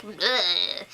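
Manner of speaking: low grunt of disdain; Mary. Retold or imitated. Mary reacting to the adoption, low dismissive noise. Mimicking Mary's mixed reaction.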